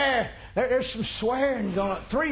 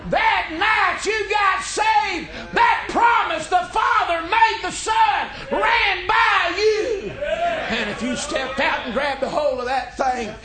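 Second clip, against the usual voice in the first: high, loud, slowed preacher's cry; preaching at full force; 9 semitones higher, 5 dB louder than usual. Acted. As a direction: shout the promise over the church. Preaching climax.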